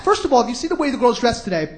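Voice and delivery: usual speaking voice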